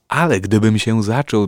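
sudden loud shout into the mic. Shown as a demonstration. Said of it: "tutaj już nie będę krzyczał".

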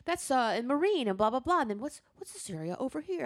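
imitating dad's questions. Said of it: "And he'd go".

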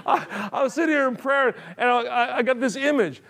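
can't stop laughing but keep going; laughing while preaching. Real, not acted. Preacher cracking up at own story.

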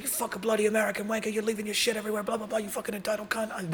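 hammed-up London guard telling off an American; mock British screaming. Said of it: "They're, like, screaming at me".

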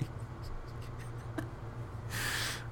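soft unvoiced giggle; breathy whispered giggle. Real, not acted. Podcaster giggling at own ASMR whisper, hushed. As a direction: giggle under your breath.

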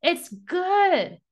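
high, emphatic declaration; impassioned reassurance to a whole assembly; full of passion. Acted. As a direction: proclaim it passionately to the crowd. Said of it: "They're literally in full passion".

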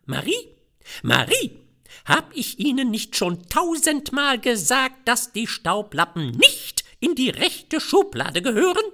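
high, loud nagging housewife calling the maid; shrill soprano. A narrator's reading. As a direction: shriek after Marie in rising panic. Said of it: "einen schrillen Sopran". (schriller keifender Sopran der Hausfrau)

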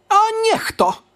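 put-on high, loud startled cry of an old woman; sudden exclamation. A narrator's reading. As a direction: jolt up remembering something. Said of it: "zerwała się gwałtownie".

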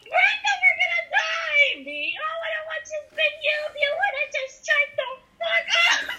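reenacting the Scary Movie chase, screaming in mock panic. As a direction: scream it like a horror victim. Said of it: "Brenda's like".

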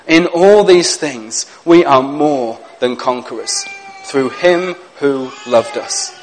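slow, hushed scripture reading with long pauses; reading scripture slowly. Shown as a demonstration. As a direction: let each verse land slowly and gently. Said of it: "I'm gonna read these verses slowly".

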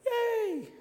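very high, drawn-out yay; hammed-up delight; mock cheer. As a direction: squeal a pretend little hooray. Mock reaction to good news.

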